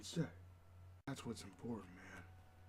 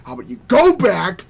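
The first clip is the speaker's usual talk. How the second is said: ordinary talk, nothing marked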